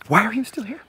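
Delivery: high-pitched exasperated demand; irritated outburst. Acted. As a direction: lose patience with a lingering underling. Snapping at lingering scout.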